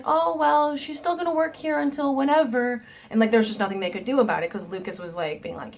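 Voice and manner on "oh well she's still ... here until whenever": mock boss voice. Boss Lucas quoted dismissively.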